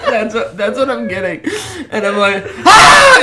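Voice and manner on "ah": sudden high loud scream. Retold or imitated. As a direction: let out a panicked scream. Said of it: "You know, I scream".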